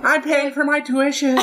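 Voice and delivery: slow, very high put-on voice with a laugh; mock student clerk. Cinema clerk excuses the job to themselves, high mock-plaintive voice. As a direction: drag it out high as the hapless clerk. Voicing the clerk's inner thoughts.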